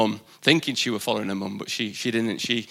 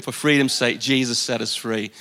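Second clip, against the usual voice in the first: normal talking voice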